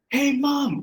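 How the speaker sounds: put-on high kid voice yelling hey mom; child calling mom. Daughter calling her mom across a crowd, high childish yell. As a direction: shout up high like a little girl.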